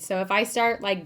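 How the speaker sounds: ordinary talk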